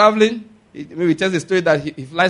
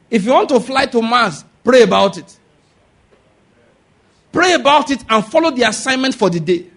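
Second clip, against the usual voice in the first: loud, raised exhortation; preaching shout; 5 semitones higher, 5 dB louder than usual. Acted. Preacher urging the congregation to pray about everything. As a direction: drive it home to the congregation.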